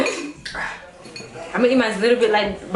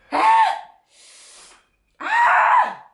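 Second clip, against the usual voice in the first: shrieks far higher and louder; real screaming; 3 dB louder than usual. Real, not acted. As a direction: shriek from the burning spice. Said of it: "Stop screaming".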